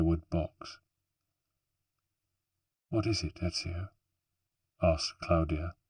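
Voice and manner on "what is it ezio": hushed, softer voice with fear in it; fearful. Acted. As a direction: ask it softly, dreading the answer. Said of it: "fear in her voice".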